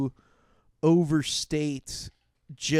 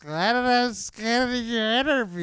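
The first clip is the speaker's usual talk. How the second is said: put-on slow, slurred, high drunk girl; tipsy party girl begging; 7 semitones higher, 3 dB louder than usual. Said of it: "It's a great drunk voice".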